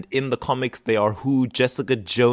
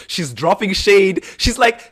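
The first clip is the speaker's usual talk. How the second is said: normal talking voice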